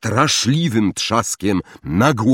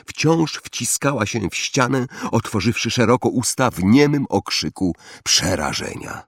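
ordinary delivery, nothing marked

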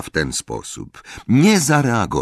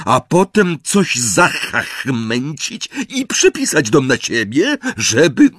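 ranting uncle; 7 semitones higher, 4 dB louder than usual. A narrator's reading. Uncle accusing Harry of stealing the house. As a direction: rant with paranoid anger.

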